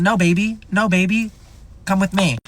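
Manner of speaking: high, coaxing comfort; calm her down gently; soothing. Retold or imitated. Soothing upset friend.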